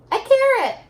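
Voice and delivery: high, loud bright answer; a teacher's cheerful payoff; excited reveal. Shown as a demonstration. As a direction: announce it with big excitement.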